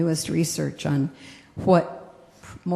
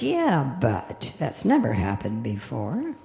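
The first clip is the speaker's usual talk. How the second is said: lower, grudging "yeah, but"; doubting little voice; 7 semitones lower than usual. Acted. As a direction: mutter the doubt down low. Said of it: "the little voice goes".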